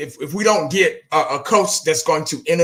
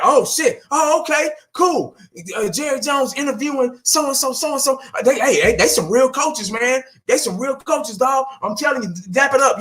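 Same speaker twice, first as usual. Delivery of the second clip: higher, hyped-up mock excitement; mock excited front office; 7 semitones higher than usual. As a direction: play the owners hyping fake coaches, pitched up. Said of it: "just to be like".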